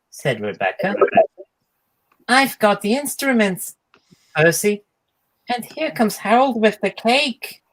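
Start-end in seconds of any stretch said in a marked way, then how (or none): 2.3-7.6 high, cheerful little engine voice; a small engine proudly reporting in; bright tank engine, pleased; Percy the engine. Acted. Percy announcing arrivals at party.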